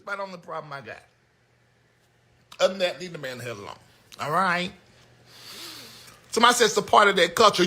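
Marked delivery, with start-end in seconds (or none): none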